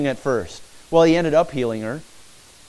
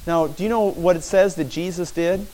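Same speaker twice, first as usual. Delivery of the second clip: ordinary talk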